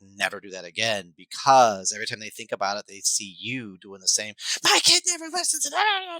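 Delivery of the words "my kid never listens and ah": high, loud, whiny mock meltdown; squealing parody of a tantrum; mock parent tantrum. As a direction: throw a bigger tantrum than the kid. Parent acts out bigger meltdown.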